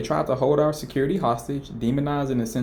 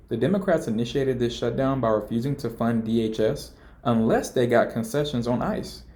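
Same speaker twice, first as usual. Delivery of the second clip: ordinary talk, nothing marked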